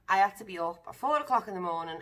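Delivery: usual speaking voice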